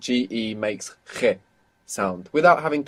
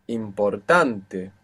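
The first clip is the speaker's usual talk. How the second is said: slow Importante with the stress on "tan"; teacher demonstrating stress, slow Importante stressed on "tan"; stress on second last; much slower than usual. Shown as a demonstration. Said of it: "the natural accent will be on the penultimate syllable".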